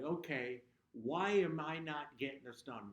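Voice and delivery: fed-up voice asking why he is not getting this done; frustrated with himself. Retold or imitated. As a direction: scold yourself for falling behind. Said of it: "I got angry with myself".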